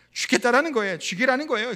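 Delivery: loud, high mock complaint; retold lament. Preacher retells Jonah lamenting to God, loud exasperated lament. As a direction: wail it out as a retold grievance. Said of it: "요나가 또 하나님께 막 한탄합니다".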